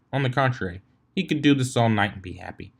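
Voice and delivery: usual speaking voice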